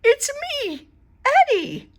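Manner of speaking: put-on very high little boy voice; small boy. A narrator's reading. Eddie tells the bear who he is, high voice. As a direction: reveal yourself.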